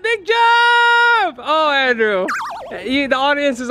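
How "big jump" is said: shouted cue, higher and louder; shouting. Real, not acted. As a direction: yell it out to the jumper. Countdown to a jump.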